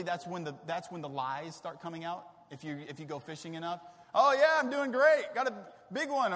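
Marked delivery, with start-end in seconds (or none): 4.1-6.3 loud, high bragging; boastful fisherman. Retold or imitated. Fisherman fibbing about a big catch, loud and boastful. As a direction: brag it up, loud and cocky.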